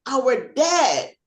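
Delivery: excited shouting. Retold or imitated. Family shouting to the officer that they found their father, overjoyed. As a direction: burst out the good news, high and loud.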